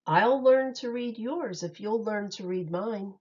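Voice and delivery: ordinary delivery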